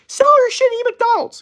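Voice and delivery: very high, loud mock hustler yell; Gary Vee impression. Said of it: "'cause that's what he sounds like".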